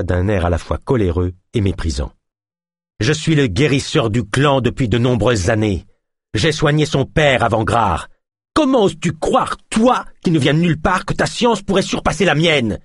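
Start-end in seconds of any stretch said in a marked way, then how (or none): 3.0-12.8 proud healer, rising to outrage; scornful old healer; indignant healer. A narrator's reading. Healer voiced in the story.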